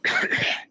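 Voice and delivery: loud hacking cough; dry cough. Acted. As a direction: cough out the dust. Coughing in dust cloud.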